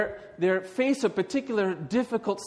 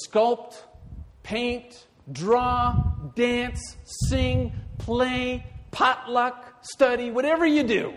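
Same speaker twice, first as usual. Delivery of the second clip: high, loud rallying list; rousing call; 8 semitones higher, 5 dB louder, slower than usual. Acted. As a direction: build it into a rallying cry.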